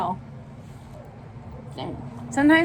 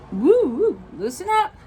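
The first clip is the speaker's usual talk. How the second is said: playful loosening up; 8 semitones higher than usual. Acted. Jim shakes loose, voiced playfully.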